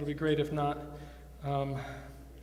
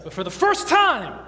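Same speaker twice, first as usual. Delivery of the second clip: loud, raised announcement; showman's build-up; 4 semitones higher, 7 dB louder than usual. Real, not acted. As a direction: build to the big reveal, punching TIME. Builds up the big reveal.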